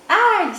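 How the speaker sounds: sharp, very high, loud ouch; cry of pain. Acted. Chick yelping as the fire burns him. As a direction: yelp in the flames. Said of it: "s'écrie Poussin".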